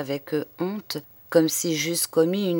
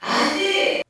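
much higher, distant call up the well; shout from far below. A narrator's reading. Stable boy calling from the well bottom, distant shout.

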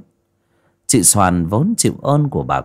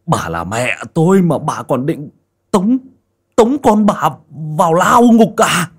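higher and louder furious accusation; enraged son; 8 semitones higher, 6 dB louder than usual. Acted. Hoàn furiously accuses his mother, louder and higher. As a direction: rise into an outraged shout. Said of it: "rít lên".

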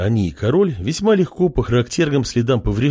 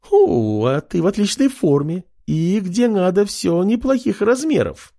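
giggling; 8 semitones higher than usual. A narrator's reading. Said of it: "захихикала".

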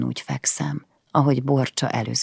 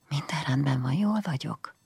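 much softer hushed reassurance; whispered; 8 dB quieter than usual. Acted. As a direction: whisper it so only she hears. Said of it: "suttogtam neki". (halk suttogás a lányának)

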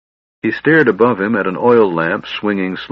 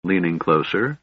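plain reading voice

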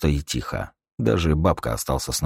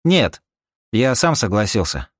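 firm loud reply; 6 semitones higher, 4 dB louder than usual. Acted. Rika replies firmly.

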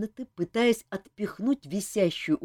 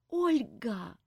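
high, pleading call; imploring; 5 semitones higher, 5 dB quieter than usual. A narrator's reading. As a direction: beg her by name. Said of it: "взмолилась она".

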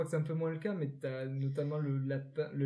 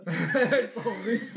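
laughing; 5 semitones higher, 5 dB louder, much slower than usual. Real, not acted.

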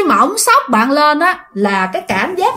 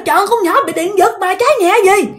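exasperated neighbor; 8 semitones higher, faster than usual. Retold or imitated. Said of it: "tiếng một người nói".

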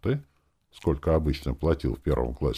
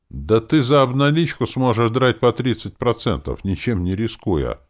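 raised, emphatic haggling; very persuasive; 7 semitones higher, 7 dB louder than usual. A narrator's reading. Woman bargaining over her commission, very persuasive. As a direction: press the point forcefully to win the deal. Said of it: "очень убедительно проговорила она".

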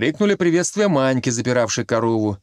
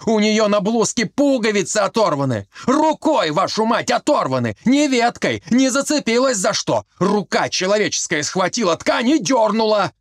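higher, heated insistence; angry outburst; 6 semitones higher than usual. A narrator's reading. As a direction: shout him down in frustration. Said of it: "вспылил Колька".

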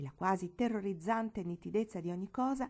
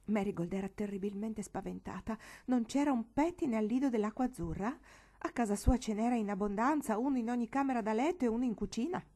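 ordinary delivery

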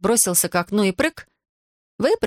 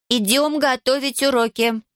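slow, higher-pitched firm order; 7 semitones higher, slower than usual. A narrator's reading. Аделаида orders Иван to go study, firm. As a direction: say it deliberately, allowing no argument. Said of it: "твёрдо произнесла Аделаида".

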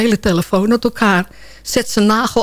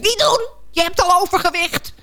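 gadget calling out; 8 semitones higher than usual. Retold or imitated. Phone catches owner at the cookie tin, calling out. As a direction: pipe up like a bossy little device. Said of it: "roept hij".

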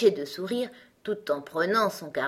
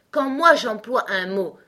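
higher, louder, haughty pronouncement; rather disdainful; 4 semitones higher, 8 dB louder than usual. A narrator's reading. Gros Coco lectures Alice on words, disdainful. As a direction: lay down the law, self-importantly. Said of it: "d'un ton assez dédaigneux".

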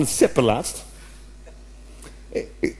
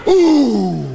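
grunted oeh; 4 semitones higher than usual. Retold or imitated. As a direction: let out a primal football grunt. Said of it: "En dan hoor je". (oergeluid oeh)

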